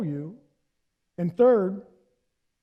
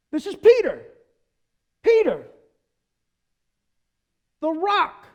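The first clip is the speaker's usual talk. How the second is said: excited emphasis; 8 semitones higher, 6 dB louder than usual. Real, not acted. Preacher exclaims Peter's name.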